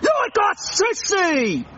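drawn-out mocking British jeer; slow sing-song British taunt; slow taunting repeat. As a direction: stretch the taunt out slowly.

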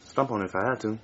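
soft, low conspiratorial coaxing; hushed urging. Acted. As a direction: lean in and coax quietly.

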